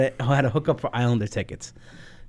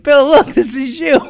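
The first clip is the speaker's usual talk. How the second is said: teasing sing-song; 12 semitones higher, 8 dB louder than usual. Retold or imitated. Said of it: "I used to show it to him".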